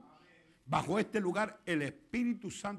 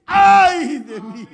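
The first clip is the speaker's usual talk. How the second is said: very loud, high wail of woe; hugely loud, stretched lament; anguished cry; 10 semitones higher, 22 dB louder, much slower than usual. Acted. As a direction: cry out in despair, long and slow. Acts sinner touched by the word.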